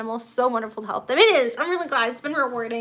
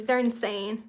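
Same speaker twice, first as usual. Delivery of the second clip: ordinary delivery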